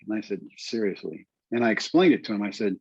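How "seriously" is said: softer, sober reply; quietly earnest. Retold or imitated. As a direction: answer softly, meaning it. He answers the scout in earnest.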